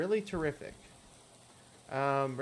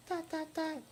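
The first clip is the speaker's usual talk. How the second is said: high voice reading the ellipsis; silent pause read out; 14 semitones higher, slower than usual. Pretend. Lydia answering with silence, read in her high voice. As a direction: say the dots as the girl's silence.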